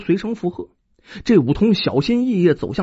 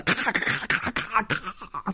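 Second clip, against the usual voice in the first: imitated thunder crackle; 10 dB quieter, slower than usual.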